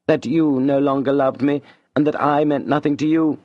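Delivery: lightly voiced wounded lover; Julien reproachful. A narrator's reading. Julien accusing Madame of no longer loving him, mild hurt. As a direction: let the hurt show a little.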